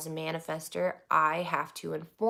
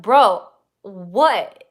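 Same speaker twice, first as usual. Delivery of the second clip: exasperated; 2 semitones higher, 13 dB louder than usual. Retold or imitated. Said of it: "I'm like".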